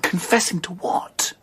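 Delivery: slow, breathy whisper. Acted. Henry asking about the Contessa's confession, slow hushed whisper. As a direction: ask it under the breath, wary.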